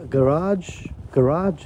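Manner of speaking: "Garage" op z'n Amerikaans, klemtoon achteraan, lange a; mimicking pronunciation. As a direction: copy the friend's "Garage" with stress on the end. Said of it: "and then you say garage".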